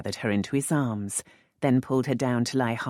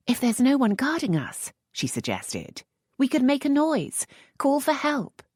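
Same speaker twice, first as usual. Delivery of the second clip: a touch higher, hushed question; lightly voiced young lady; 12 semitones higher than usual. A narrator's reading. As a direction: Lisette asking the earl in the dark, lightly higher. Lisette voiced by narrator.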